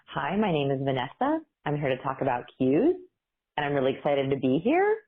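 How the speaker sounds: uptalk, each phrase rising at the end; question inflection. Shown as a demonstration. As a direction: end every phrase going up, as if asking. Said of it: "we go up at the end of our sentence".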